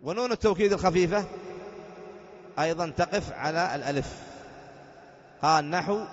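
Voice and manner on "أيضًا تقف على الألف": ordinary talk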